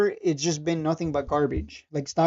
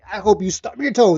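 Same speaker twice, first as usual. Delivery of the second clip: mock sarcasm; 3 semitones higher, 8 dB louder than usual. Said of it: "say something sarcastic like".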